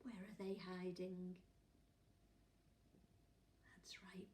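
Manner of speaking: soft, unvoiced whisper. Real, not acted. The reader whispers to the children about who is hiding.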